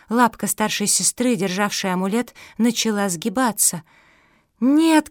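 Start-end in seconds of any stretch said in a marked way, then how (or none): 4.6-5.1 high, loud cry of protest; shout. A narrator's reading. Mafi begs her sister not to give up, shouting. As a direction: cry out in alarm. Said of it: "крикнула Мафи".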